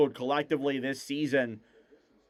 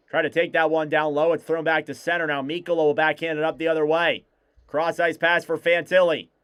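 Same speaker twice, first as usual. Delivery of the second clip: play-by-play; 3 semitones higher, 4 dB louder than usual. Acted. Live hockey play-by-play.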